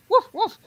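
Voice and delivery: high-pitched pretend woofs; dog bark imitation.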